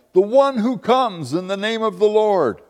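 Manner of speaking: high, proclaimed acclamation; crowd calling out. Acted. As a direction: proclaim it like a crowd hailing someone. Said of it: "called him".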